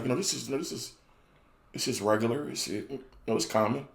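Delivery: a hint of a calm, reassuring doctor. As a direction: soothe the patient, downplay it.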